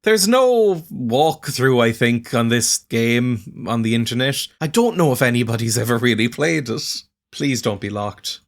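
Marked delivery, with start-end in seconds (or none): none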